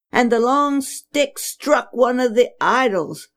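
ordinary delivery, nothing marked

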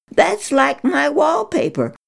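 high, pleading eagerness; eager pleading. Acted. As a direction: beg to hear it is true. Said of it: "she cried".

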